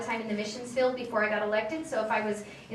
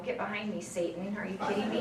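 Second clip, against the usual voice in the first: lower, softer mock rebuke as if to Satan; 3 semitones lower, 4 dB quieter than usual. Softer mock rebuke to the congressman's idea. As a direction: push the tempter away, half joking. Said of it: "I was like".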